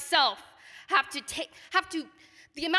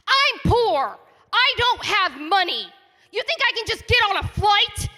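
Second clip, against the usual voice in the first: higher and louder, heated and indignant; angry outburst; 5 semitones higher, 4 dB louder than usual. Real, not acted. Resident lashing out at developers at a hearing, angry and raised. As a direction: fire it back at them, stung and furious.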